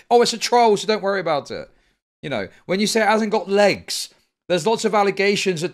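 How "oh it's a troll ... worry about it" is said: higher and louder sneering parody; mocking quote. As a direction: throw their excuse back at them.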